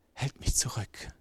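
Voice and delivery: whispered, defeated confession. Acted. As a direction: breathe it out as a broken man. Broken alcoholic confessing.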